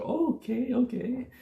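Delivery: high, lightly voiced German friend going "Oh, okay, okay"; mock friend realising. Vlogger retells German friend finally understanding the city name, high mock voice. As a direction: pitch up into a friend's sudden recognition. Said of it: "It's like".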